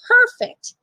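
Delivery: put-on high, loud little-boy delight; boy happy with his ice cream; delighted child. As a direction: squeal the word like a thrilled kid.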